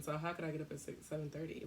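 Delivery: ordinary talk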